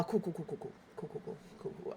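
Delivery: soft, rapid string trailing away; covering fury with quiet, dwindling repetition; masking anger. Shown as a demonstration. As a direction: mutter it off as if not bothered. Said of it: "not show that I'm super mad".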